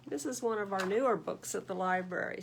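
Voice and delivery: usual speaking voice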